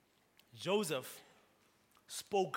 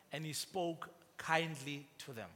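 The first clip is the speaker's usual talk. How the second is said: soft tender repeat; 4 dB quieter than usual. Shown as a demonstration. Preacher repeats verse, softer, slower.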